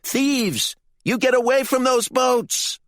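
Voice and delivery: loud, very high accusing yell. A narrator's reading. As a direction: yell it at thieves from afar.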